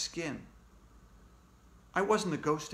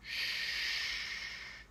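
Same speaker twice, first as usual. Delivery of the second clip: voiceless, soft hush; quiet him without voice; whispered shush; 8 dB quieter than usual. Acted. Ghosts hushing the kid again, whispering.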